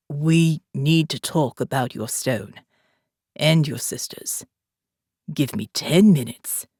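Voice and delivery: put-on calm commander, a light South African accent; faint South African accent. A narrator's reading. Jake speaks to Morgan.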